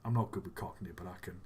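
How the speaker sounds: ordinary delivery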